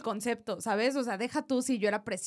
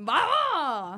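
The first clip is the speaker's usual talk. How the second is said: cheer; 4 semitones higher, 6 dB louder than usual. Real, not acted. Cheering after 'Arriba México'.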